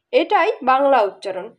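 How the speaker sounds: usual speaking voice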